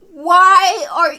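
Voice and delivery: loud, high, stretched protest; cut-off frustrated demand; exasperated outburst. Real, not acted. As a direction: burst out in frustration. Exasperated at a character.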